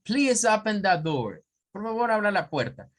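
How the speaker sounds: American accent demo. Shown as a demonstration. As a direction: say it American style. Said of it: "eso es inglés norteamericano".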